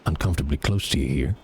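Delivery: deep, close warning; low menacing character. Acted. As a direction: lean in and warn in a low voice.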